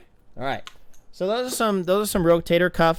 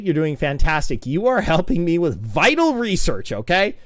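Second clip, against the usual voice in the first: normal talking voice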